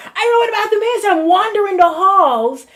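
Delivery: high, wailing retelling of being lost; mock helpless child; lost and bewildered. Acting out lost new pupil.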